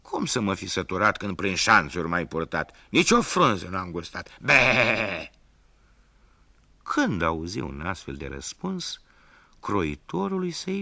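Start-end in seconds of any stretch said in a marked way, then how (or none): 0.0-5.3 loud whiny goat ending in bleat; spiteful goat complaining. Acted. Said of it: "după cum era obiceiul".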